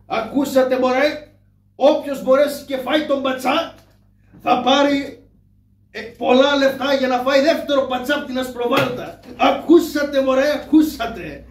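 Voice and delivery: loud, high crier's shout; town crier call. Acted. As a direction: shout until you deafen yourself. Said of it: "κουβάθηκα απ' τη φωνή μου".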